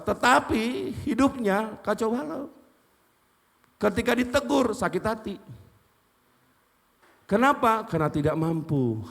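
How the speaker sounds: a fiery sermon delivered to the congregation; khotbah berapi-api. Acted. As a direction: preach it loud and forceful. Preaching at full force. (khotbah dengan suara keras dan penuh semangat)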